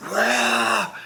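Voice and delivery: high-pitched mock crowd yell; a drawn-out "wah" imitating the crowd; imitated crowd roar. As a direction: cheer like a screaming crowd. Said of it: "Everybody was yelling and screaming".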